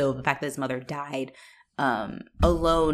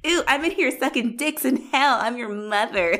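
camp demon voice; 6 semitones higher than usual. Retold or imitated.

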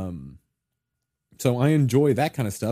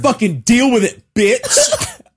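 defiant; 8 semitones higher, 5 dB louder, slower than usual. Retold or imitated. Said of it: "And then I'm just like".

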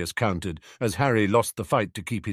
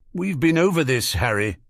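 higher, louder cut-in; interrupting; 6 semitones higher, 6 dB louder than usual. A narrator's reading. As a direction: cut in, exasperated. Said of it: "Sirius interrupted".

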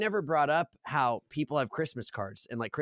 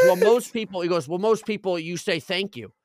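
imitating old man; 4 semitones higher, 6 dB louder than usual.

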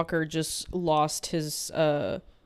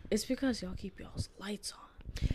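whispering; 3 semitones higher, 8 dB quieter than usual. Real, not acted. Teasing whisper aside.

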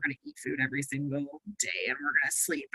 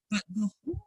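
muffled, mic covered by hand; finger over the mic; 3 semitones higher, 5 dB quieter than usual. Real, not acted. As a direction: sound blocked by a hand on the mic. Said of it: "I don't know if you're covering the mic".

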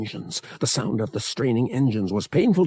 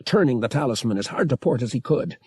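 ordinary delivery

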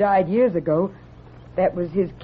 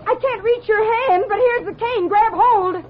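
high, urgent pleading; frantic, trying to save him; desperate; 10 semitones higher than usual. Acted. Hero hangs from the cliff.

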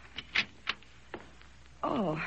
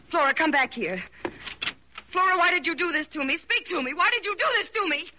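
rising to a high, loud, tearful scream; crying with rage; 9 semitones higher, 4 dB louder than usual. Acted. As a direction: shout after her, near tears with rage. Calls after the maid who slapped her.

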